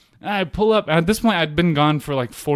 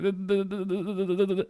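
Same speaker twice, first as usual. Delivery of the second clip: soft, higher string of stuttered duhs; imitated juddering car; 6 semitones higher, 7 dB quieter than usual. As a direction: judder along like a jerky car. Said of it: "I'm just like".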